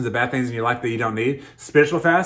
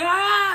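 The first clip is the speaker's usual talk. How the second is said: very high delighted squeal; high gleeful ah of a thrilled toddler; excited child's squeal. Retold or imitated. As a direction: squeal like a kid opening a gift. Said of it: "he's like".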